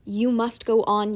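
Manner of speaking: ordinary delivery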